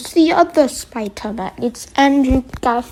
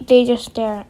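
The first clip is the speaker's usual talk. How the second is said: usual speaking voice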